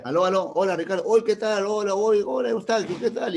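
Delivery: high, loud calling into the phone; phone greeting. Retold or imitated. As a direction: greet someone on a bad line. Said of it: "Habla por teléfono". (saludo alto y fuerte por teléfono)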